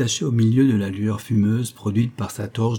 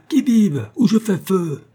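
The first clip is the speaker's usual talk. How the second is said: high, loud sentry's warning; urgent high call with a threat behind it; final shouted challenge; 9 semitones higher, 5 dB louder than usual. A narrator's reading. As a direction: shout a last warning before shooting. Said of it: "Une troisième fois".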